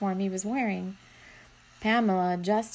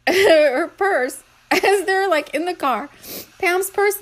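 laughing; 7 semitones higher, 6 dB louder, slower than usual. Real, not acted. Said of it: "It's making me laugh".

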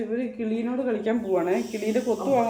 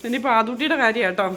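ordinary delivery

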